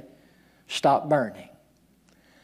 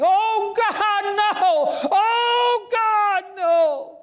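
high, anguished cry of grief; wailing; 3 dB louder, much slower than usual. Retold or imitated. Preacher acting a father's wail in the hospital, anguished cry. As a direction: cry out as if a child is dying.

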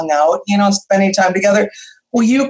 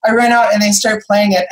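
ordinary talk, nothing marked

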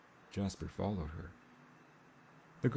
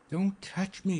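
high-pitched alarmed protest; urgent, frightened warning; breathed alarm; 11 semitones higher, 4 dB louder, slower than usual. A narrator's reading. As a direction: recoil from someone's touch, voice pitched high. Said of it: "she breathed".

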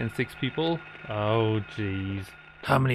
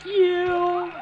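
very high, loud sheepish yeah; mock squeaky confession; 7 dB louder than usual. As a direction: voice her guilty little yes. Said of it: "Girl, did you clog the toilet?".

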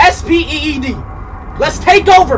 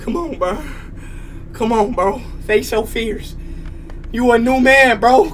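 quiet self-talk; 9 semitones lower, 12 dB quieter than usual. Real, not acted. Psyching himself up quietly.

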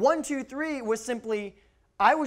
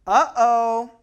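loud, higher sing-out "uh-oh"; mock dismay; 4 semitones higher, 12 dB louder than usual. Dad spots wrongdoing before a spanking, mock dismay. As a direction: sound playfully alarmed, loud and raised.

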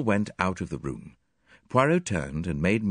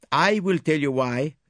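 put-on higher, louder French-accented detective, pleased; French accent, smug and precise; Poirot presenting his witnesses in a French accent; 9 semitones higher, 4 dB louder than usual. A narrator's reading. Voices Poirot.

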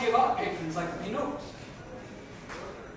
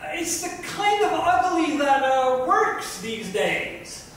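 higher, louder, slow drawn-out plea; pleading stylist; 7 semitones higher, 4 dB louder, slower than usual. Acted. Stylist pleading with the Lebanese designer, high and slow. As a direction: stretch the words out, begging the designer to see it. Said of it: "the stylist pleaded".

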